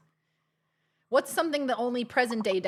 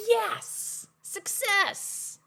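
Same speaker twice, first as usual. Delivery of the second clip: excited cheer; 10 semitones higher, much slower than usual. Real, not acted. Celebrating a bargain.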